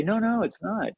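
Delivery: mildly emphatic correction; firm denial. Shown as a demonstration. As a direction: brush off the claim cheerfully. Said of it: "But you say".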